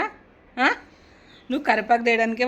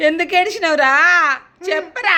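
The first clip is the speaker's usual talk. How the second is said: raised, higher-pitched questioning; loud demanding; 6 semitones higher, 6 dB louder than usual. Real, not acted. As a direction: press loudly for an answer. Pressing boy to say why he cried.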